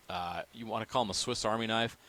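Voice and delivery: normal talking voice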